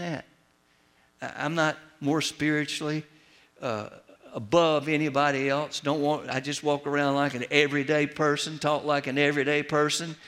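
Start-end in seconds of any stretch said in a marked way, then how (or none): none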